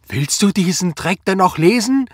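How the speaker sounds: high, loud outraged yell; screaming at him. A narrator's reading. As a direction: scream it at your husband. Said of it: "schrie Anna ihn an". (wütend angeschrien)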